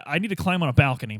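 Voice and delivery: ordinary talk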